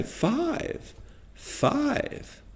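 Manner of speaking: "five" soft and very high; high intonation. Shown as a demonstration. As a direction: lift "five" up as if raising the eyebrows. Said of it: "Practice lifting your eyebrows up and say".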